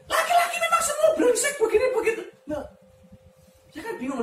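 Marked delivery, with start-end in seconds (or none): 0.1-2.2 much higher, louder shrill ranting woman; hammed-up angry housewife on the phone; mock angry caller. A caller ranting at the pastor, mock high and loud. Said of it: "Dia ngomong".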